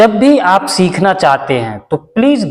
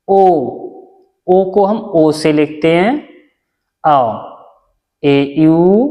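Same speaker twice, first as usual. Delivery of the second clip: each vowel and its spelling called out slowly; teacher chanting the vowel chart, one sound at a time; slow recitation drill; 2 semitones lower, much slower than usual. Shown as a demonstration. Hindi teacher reciting vowels slowly to students. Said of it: "recites vowels and spellings".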